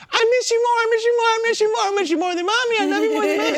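loud, fast falsetto gushing; high-pitched dog voice. Retold or imitated. Dad outdoing mommy with the dogs, high excited gushing. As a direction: gush frantically in squeaky baby talk. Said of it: "Why you do the hyphen voice?".

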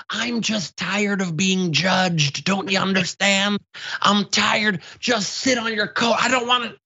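high, whiny news anchor building to a shout; a frazzled anchor who is fed up and yells; Albert Brooks vibe. Acted. Comedian spoofs a tired news anchor, whiny and rising to a shout. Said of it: "the news anchor from Network".

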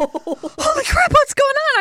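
high, slow startled shout with a laugh. Retold or imitated. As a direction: jump out of your skin up high. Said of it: "And I'm like".

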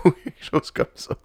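breathy, still-giggling aside; laughing it off. Real, not acted. Host shrugging off the silly title, half-laughing. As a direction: say it through the last of the laugh.

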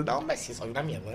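low, soft mock surrender; quiet self-put-down; meek reply. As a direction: mumble it like backing down. Meek own reply to hecklers.

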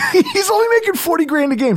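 high-pitched, laughing repeat; amused disbelief. Real, not acted. Podcaster joking about a player's pay, high laughing disbelief. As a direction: squeal it through a laugh.

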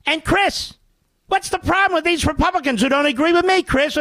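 Scarborough impression. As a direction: egg on the guest like a cable host. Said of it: "from doing Scarborough".

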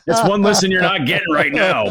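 high, laughing through the words; amused, laughing it off. Real, not acted. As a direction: talk while still laughing at yourself. Laughs at his own throat-clearing.

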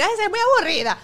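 high, loud scornful jab; shrill-free mocking whine of the group; mock sneer. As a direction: tease her as the boring one. Imitating the group mocking her.

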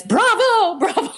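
high-pitched, laughing mock cheer; erupting cheer. Imitating the Ukrainian woman erupting in church, high mock cheer. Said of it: "she was erupting".

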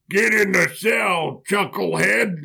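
slow, barked guard order; lightly hammed-up tough jailer; gruff guard. Guard ordering Joseph into the cell, mock bark. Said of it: "And they're like".

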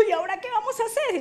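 high, hurried wail; imitating a weeping, panicked man; mock tearful panic. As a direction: rush it out high, as if in tears. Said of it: "se puso a llorar y dijo".

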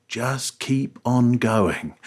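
stretched out slowly with a chuckle; slow, deliberate. Retold or imitated. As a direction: spell out "Just keep on going" slowly. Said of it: "and he said".

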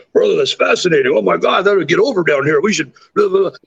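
hammed-up excitement, tumbling into blah-blah; imitating excited Jake. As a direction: play your buddy gushing over a big idea. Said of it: "and he's like".

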